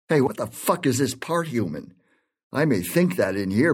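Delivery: ordinary talk, nothing marked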